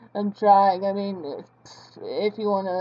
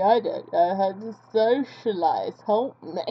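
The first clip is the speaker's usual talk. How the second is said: ordinary delivery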